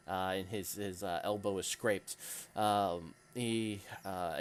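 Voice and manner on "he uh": usual speaking voice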